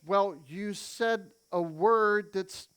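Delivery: ordinary talk, nothing marked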